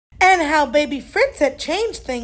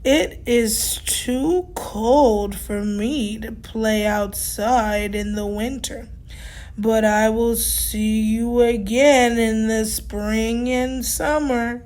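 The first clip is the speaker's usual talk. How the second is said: low, slow and unhurried; Tortoise answering Fiona slowly; slow old tortoise; 3 semitones lower, slower than usual. Acted. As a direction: take your time like an ancient tortoise. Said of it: "Tortoise said slowly".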